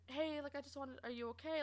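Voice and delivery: softly checking in on someone; gentle, hushed concern, imitated. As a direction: ask it carefully and quietly. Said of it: "I made sure to talk in a soft tone".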